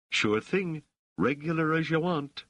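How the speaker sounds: put-on high, playful Dahlite street accent. A narrator's reading. Rochelle humours the boy, in his Dahlite accent. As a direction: answer breezily in Dahlite slang accent. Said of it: "That's quite an accent you have".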